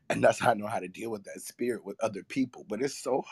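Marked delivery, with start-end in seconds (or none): none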